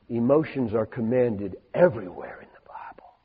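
intense whispered emphasis. Real, not acted. Preacher to congregation, a hushed intense whisper. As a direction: lean in and whisper it like a secret.

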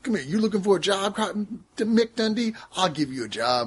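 lightly voiced tough-guy pimp; badass pimp dude. Pimp offering Mick Dundee a job, badass act. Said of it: "who's this badass pimp dude".

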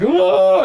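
loud, high cry of alarm; mock panicked yell. Philistine army going nuts and running off, mock panic. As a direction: imitate an army yelling as it flees. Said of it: "the Philistine army goes nuts".

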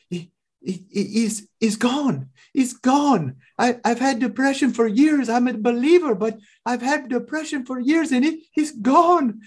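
a stunned woman realizing her depression has lifted; amazed disbelief. Retold or imitated. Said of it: "she just has this bewildered look".

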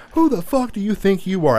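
high-pitched mock yell at the kids; imitating dad blowing up at them; retold furious yell. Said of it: "slams the phone down and grabs the kids".

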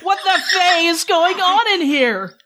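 furious succubus bursting into the room; roaring demand. Acted. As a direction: burst in yelling. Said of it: "Beau's voice roaring through the bedroom".

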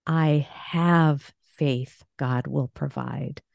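"have" drawn out, then a pause; stress on HAVE. Shown as a demonstration. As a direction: slowly press on "have". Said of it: "here's a positive faith affirmation".

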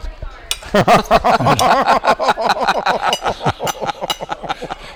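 loud high burst of laughter; big laugh. Real, not acted. Telling the Zanardi story, bursts out laughing. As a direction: roar with laughter at the punchline.

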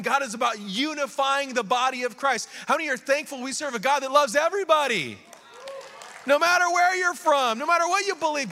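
high, loud, driving sermon delivery; preacher in full cry; impassioned preaching. Real, not acted. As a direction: build to a rousing shout, then bring it down.

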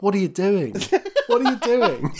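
laughing through a bemused teacher's question; baffled teacher. Retold or imitated. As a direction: play the puzzled teacher, giggling. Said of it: "teachers at the door was like".

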